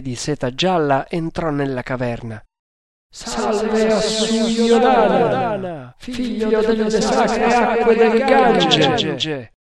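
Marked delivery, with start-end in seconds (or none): 3.1-9.5 many layered voices hailing in unison; drawn-out ritual hail from a whole chorus; chorus acclamation. A narrator's reading. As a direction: chant it high and slow like a crowd. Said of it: "esclamarono in coro i quaranta indiani".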